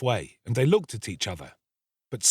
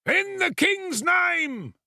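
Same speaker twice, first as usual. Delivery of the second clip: high, slow, drawn-out official shout; crowd outside calling; much slower than usual. Acted. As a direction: bellow it from beyond the door. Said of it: "cried the voices without".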